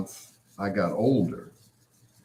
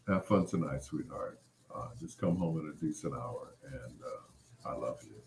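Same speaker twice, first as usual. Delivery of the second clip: soft, low, tender and sweet; tender smooth voice; 5 semitones lower, 12 dB quieter than usual. Shown as a demonstration. Parent sending a kid off for the night, soft and tender. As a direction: ease it out soft like a loving parent. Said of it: "never in that voice".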